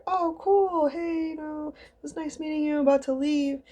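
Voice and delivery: very high, squeaky small talk; hammed-up sweet farewell; mock politeness. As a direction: act out an over-nice goodbye way up high. Acted polite goodbye to a fan.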